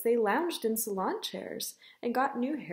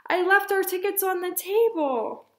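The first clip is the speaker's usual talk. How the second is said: high, loud storybook dismay; 8 semitones higher, 5 dB louder than usual. Acted. Mother confesses forgotten tickets to Ava, dismayed. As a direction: exclaim the awful realization, pitched up.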